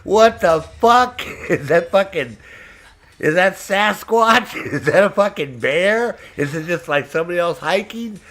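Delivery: laughing, startled yells at noises in the woods; mock startled. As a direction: act spooked by a sound in the bushes, half laughing. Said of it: "And you're like".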